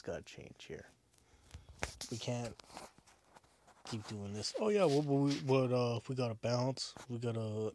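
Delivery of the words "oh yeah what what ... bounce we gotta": loud mocking stammer. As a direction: parody a flustered excuse. Mocking fans' excuses.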